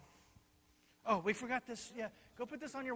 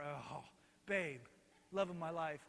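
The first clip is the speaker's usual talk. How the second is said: low, soft swoon; lovestruck; 5 semitones lower, 9 dB quieter than usual. Acted. King swooning as his queen comes in, lovestruck. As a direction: sigh it out like a smitten husband. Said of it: "He's just in love with her".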